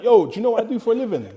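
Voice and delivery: loud, high, quick retort; indignant challenge. Retold or imitated. Player confronting the teacher who insulted him, loud and indignant. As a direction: fire it back, offended. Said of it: "I'm like".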